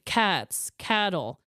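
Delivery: normal talking voice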